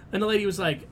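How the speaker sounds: ordinary talk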